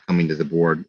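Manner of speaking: ordinary talk, nothing marked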